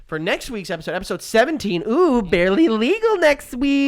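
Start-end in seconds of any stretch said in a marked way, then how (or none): 1.9-3.9 slow, louder sly tease; teasing. Real, not acted. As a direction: make it cheeky. Teasing next episode number.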